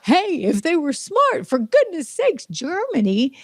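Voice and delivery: a high exasperated "for goodness sakes"; exasperated scolding. Pretend. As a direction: chide Germany like a naughty child. Mock scolding Germany.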